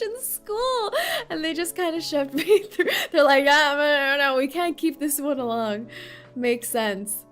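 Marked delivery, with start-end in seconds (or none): none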